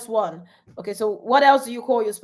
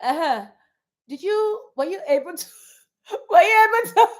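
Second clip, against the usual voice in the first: teasing husband; 7 semitones higher, 4 dB louder, faster than usual. Retold or imitated. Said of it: "He'd be like".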